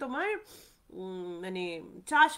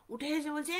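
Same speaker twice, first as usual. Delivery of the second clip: ordinary talk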